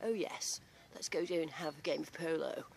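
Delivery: a light mock upper-class English accent, soft; mock posh English. Mocking how the Queen talks, soft posh English accent. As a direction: send up a posh English toff, understated. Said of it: "I don't talk like the Queen".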